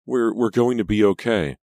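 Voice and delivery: high, loud, stammering exclamation; acted burst of relief; overcome with relief. A narrator's reading. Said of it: "exclaimed with a shudder of relief".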